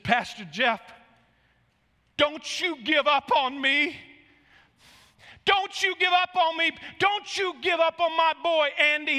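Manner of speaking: high, loud, slow impassioned plea; crying out. Retold or imitated. Sober Raul begging the pastor by phone, crying out. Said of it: "he cried out".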